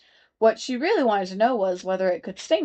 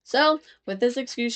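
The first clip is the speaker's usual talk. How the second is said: ordinary delivery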